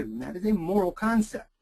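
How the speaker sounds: usual speaking voice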